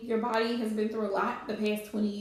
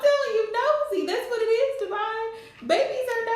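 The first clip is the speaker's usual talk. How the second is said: excited; 11 semitones higher, 5 dB louder than usual. Real, not acted. Excited exclamation, loud high.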